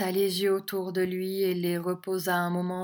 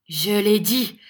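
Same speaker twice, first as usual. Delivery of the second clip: loud, firm assertion; unmoved firmness; 2 semitones higher, 8 dB louder than usual. A narrator's reading. Young teacher repeating himself to the schoolmaster, unmoved firmness. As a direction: stand your ground firmly. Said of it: "répéta Nicolas sans se laisser émouvoir".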